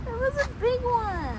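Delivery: still shaken, high. Real, not acted. After the spider.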